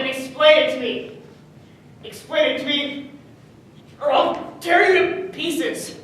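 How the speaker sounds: loud and high, slowing to a menacing threat; furious threat. Acted. Son threatens his father, enraged. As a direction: demand answers or else.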